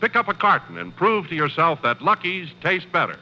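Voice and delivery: usual speaking voice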